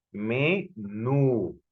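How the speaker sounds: "Menú" split slowly into ME and NÚ; syllable-by-syllable demo. Shown as a demonstration. Teacher repeating the word for students, syllabified "Menú". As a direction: say "Menú" in two drawn-out syllables, NÚ stressed. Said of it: "repito nuevamente la palabra".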